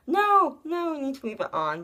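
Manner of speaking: high, loud nurse protest, then quick; insistent nurse. Retold or imitated. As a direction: nurse calling out no. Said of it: "and the nurse was like".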